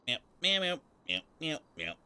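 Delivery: high playful meows, fading down; cat meowing. Pretend. Streamer meowing while waiting.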